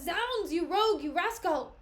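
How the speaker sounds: loud, angry insult; angry shout. Acted. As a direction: yell at the rogue. Cassio attacks Rodrigo.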